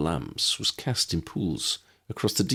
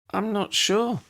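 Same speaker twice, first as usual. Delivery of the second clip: put-on much higher girlish voice; a light, high girl's voice; young woman voice; slower than usual. A narrator's reading.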